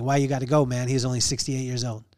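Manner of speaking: ordinary delivery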